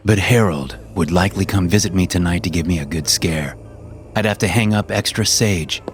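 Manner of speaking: ordinary delivery, nothing marked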